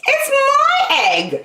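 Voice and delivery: very high, slow squawk; Maisie spurting. Acted. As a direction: blurt it out, possessive. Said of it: "she spurted".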